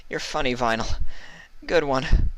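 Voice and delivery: breathy laugh into high, nervous words. A narrator's reading. Mully laughs off gun at her head, nervous. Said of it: "laughing nervously".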